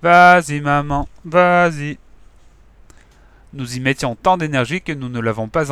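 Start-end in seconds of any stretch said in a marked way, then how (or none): 0.0-2.0 loud, then slow drawn-out cheering; children cheering their mother as she fights. A narrator's reading. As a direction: cheer her on with everything. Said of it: "nous nous sommes mis à encourager notre mère".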